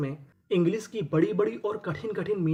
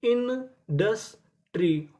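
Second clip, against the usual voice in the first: slow spelled-out reveal; 3 semitones higher, much slower than usual. Shown as a demonstration. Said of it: "तो इतनी meaning क्या बनी?".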